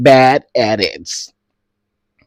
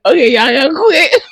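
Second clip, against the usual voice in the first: high, loud plea through tears of laughter; laughing so hard it hurts; 1 laughing shout; 13 semitones higher, 4 dB louder than usual. Real, not acted. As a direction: beg them to stop while crying laughing. Laughing, begging viewers stop.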